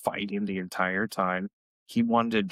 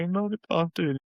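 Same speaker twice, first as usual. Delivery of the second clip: much higher mock crying protest; mock crying whine; 8 semitones higher than usual. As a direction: imitate a crying kid brother protesting. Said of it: "he's now crying".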